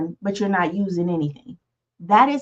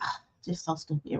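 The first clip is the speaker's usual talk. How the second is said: muttered self-criticism; 4 semitones lower, 13 dB quieter than usual. Retold or imitated. Quoted self-talk, muttered low.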